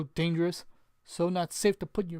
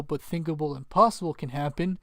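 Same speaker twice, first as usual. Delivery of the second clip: ordinary talk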